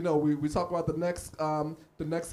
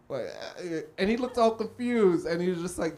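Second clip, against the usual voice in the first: ordinary talk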